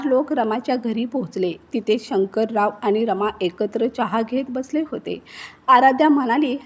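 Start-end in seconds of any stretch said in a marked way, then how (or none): none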